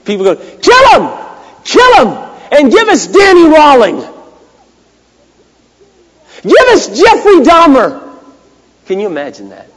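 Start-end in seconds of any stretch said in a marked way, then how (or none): 0.4-8.1 high, loud crowd chant in imitation; screaming mob. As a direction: scream it like a crowd demanding an execution. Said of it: "is screaming".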